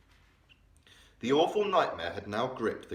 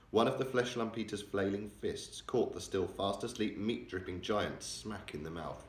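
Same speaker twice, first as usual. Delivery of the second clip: ordinary delivery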